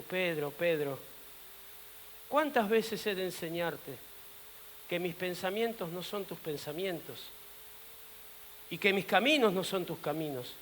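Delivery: soft, low, patient correction; gentle rebuke. Acted. The Master corrects Peter at the footwashing, softly reproving. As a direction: hush the voice to admonish a disciple.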